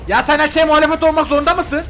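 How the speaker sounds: high, loud exasperated shout; exasperated yell. Real, not acted. Gamer yells at interfering mob, high loud exasperation. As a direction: yell at the mob that defies him.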